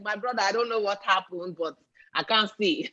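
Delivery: put-on Nollywood character in a Nigerian accent. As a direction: plead your case in a Nigerian accent. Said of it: "when you bring in the Nigerian accent".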